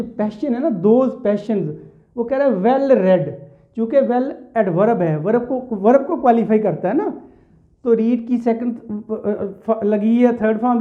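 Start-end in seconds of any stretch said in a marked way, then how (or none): none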